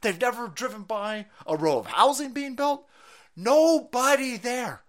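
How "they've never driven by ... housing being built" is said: ordinary delivery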